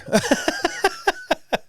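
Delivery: high laugh. Real, not acted. Laughing at own joke.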